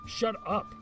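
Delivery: exasperated retort; annoyed. Real, not acted. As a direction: cut the email off, irritated. Retort to the email.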